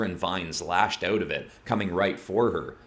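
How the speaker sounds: ordinary delivery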